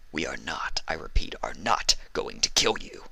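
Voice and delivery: quietly exasperated repeat; annoyed snap. Acted. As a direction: hiss it through annoyance. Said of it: "he snapped, clearly annoyed".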